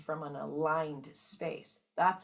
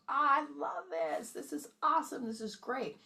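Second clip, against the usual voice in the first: delighted; 8 semitones higher than usual. Retold or imitated. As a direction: savour it like a treat. Speaker acting out love emotion.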